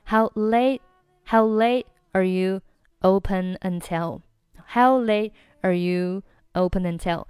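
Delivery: slow "How late are you", dropping the t of "late"; chunked "late / are you / open until"; unlinked, silent t. Shown as a demonstration. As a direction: break after "late", leaving its t unreleased. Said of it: "仅仅是late末尾的t不发音".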